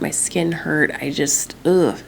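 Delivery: ordinary talk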